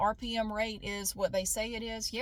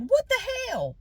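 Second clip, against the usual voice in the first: sharp high shocked cry; panicked exclamation; 9 dB louder than usual. Retold or imitated. Alone after reading the news, panicking. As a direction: blurt it out in alarm. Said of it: "That's my version of panicking".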